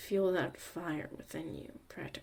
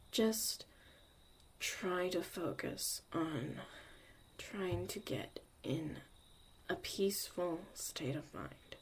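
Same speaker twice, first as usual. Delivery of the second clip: guided meditation. Acted. Leads a breathing meditation.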